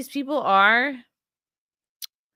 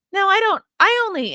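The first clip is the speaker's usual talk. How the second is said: much higher and louder, a hammed-up yelp; mock flustered protest; 12 semitones higher, 7 dB louder than usual. Mocking his own squeaky protest when looking at the clips.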